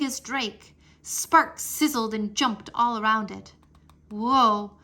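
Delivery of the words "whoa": loud, higher wondering whoa; amazed. A narrator's reading. Drake amazed at the giant golden egg. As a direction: gasp at something huge and strange. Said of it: "Drake asked".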